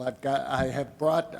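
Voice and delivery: ordinary talk, nothing marked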